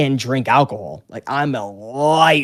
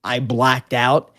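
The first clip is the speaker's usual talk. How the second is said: ordinary talk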